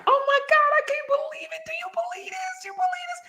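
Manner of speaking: very high squealing joy; imitating her tearful joyful squeal; overjoyed disbelief. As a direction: shriek it in happy disbelief. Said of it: "it was like".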